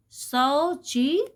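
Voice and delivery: सौ and ची said apart, each one slow and distinct; teacher joining syllables slowly; slow syllable spelling. Shown as a demonstration. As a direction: build the word सौ ची piece by piece. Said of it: "तो ये दोनों बन जाएंगे सौ".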